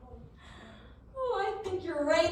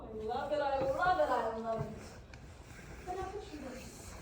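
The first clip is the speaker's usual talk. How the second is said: ordinary talk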